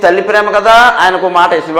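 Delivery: ordinary talk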